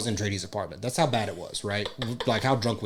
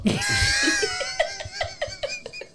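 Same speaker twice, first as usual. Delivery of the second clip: shrieking laugh. Real, not acted. Laugh shout.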